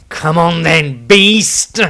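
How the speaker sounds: rising, shouted taunt at the monster. Acted. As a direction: goad the beast into charging. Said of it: "taunted Dieter".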